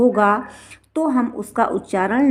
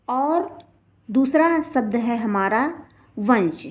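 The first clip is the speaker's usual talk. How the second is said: ordinary talk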